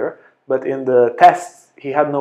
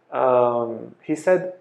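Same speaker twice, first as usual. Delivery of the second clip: ordinary talk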